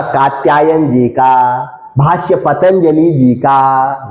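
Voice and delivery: low, slow, measured listing; drawn-out answer. Shown as a demonstration. Teacher supplying the grammarians' names to students, drawn out. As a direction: stretch out each name deliberately.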